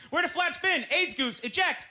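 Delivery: high, urgent movie-pilot call; mock cockpit panic. Pilot ordering co-pilot out of spinning jet, mock cockpit panic. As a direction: call out a pretend emergency. Parody of film cockpit emergency.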